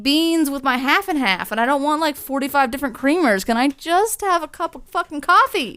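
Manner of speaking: high, loud exasperated mock rant; mock coffee rant. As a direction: rant like a fed-up customer. Ranting at fancy coffee shop.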